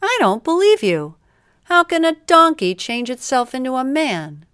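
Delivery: high, loud incredulous outburst; disbelief. A narrator's reading. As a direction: refuse to believe it, pitch shooting up. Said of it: "said the man".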